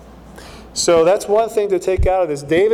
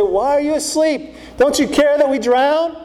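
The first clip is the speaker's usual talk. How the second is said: high, alarmed cry to a sleeping Jesus; panicked plea; 7 semitones higher than usual. Retold or imitated. Disciples waking Jesus in the storm, alarmed and pleading. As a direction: plead in fear of drowning.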